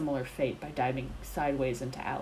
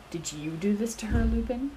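higher and louder, rising accusation; a hint of a wary, probing accuser; suspicious question; 6 semitones higher, 5 dB louder than usual. A narrator's reading. Severus confronting Remus, mildly suspicious accusing question. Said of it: "calculating and suspicious".

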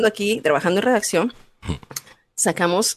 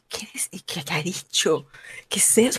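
hushed puzzled murmur; 8 dB quieter than usual. Retold or imitated. Diners puzzled by the word.

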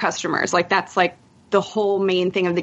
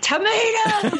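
high-pitched mock yell; imitated yelling; 13 semitones higher, slower than usual. Imitating boyfriend yelling while they play, high mock yell. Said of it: "he will just yell at things".

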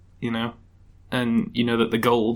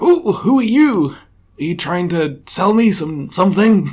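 put-on old man; 7 semitones higher, 5 dB louder than usual. Lonely old judge greets the visitor, old man voice. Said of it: "I'll just do an old man voice".

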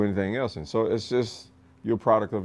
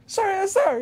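recruit answering. Retold or imitated. Recruit answering drill instructor.

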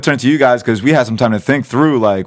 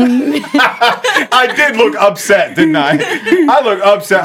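laughing; 11 semitones higher, much slower than usual. Real, not acted. Laughing at own photo.

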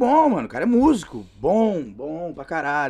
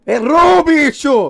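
loud, high-pitched exclamation; mock shout; 8 semitones higher, 10 dB louder, much slower than usual. Guest jumping into the story about equality gone wrong, mock shout. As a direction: shout it out as a sudden comic verdict.

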